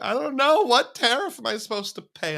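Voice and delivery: high-pitched baffled question; exasperated confusion. Retold or imitated. Confused driver at the parking machine, exasperated. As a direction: throw it up high, at a loss.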